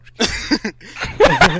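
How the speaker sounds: high, loud, laughing giggle. Real, not acted. Laughing after the joke line.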